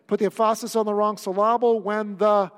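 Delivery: loud "em-FAH-sis" for "emphasis", "sil-LAH-ble" for "syllable"; projected joke mispronunciation of "emphasis" and "syllable", second syllable stressed; wrong-syllable stress. Shown as a demonstration.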